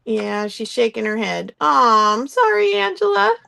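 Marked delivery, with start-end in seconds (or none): 1.6-3.4 sympathetic. Real, not acted. Viewer cut off by technology.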